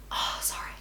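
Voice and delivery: real whispered apology; barely voiced, halting apology; hushed apology. Real, not acted. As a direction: break off and whisper sorry. Breaks off telling a painful story.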